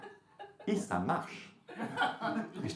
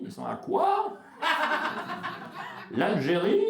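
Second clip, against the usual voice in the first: clueless bloke voice; 4 dB louder, slower than usual. Retold or imitated. Voicing clueless Hubert joke.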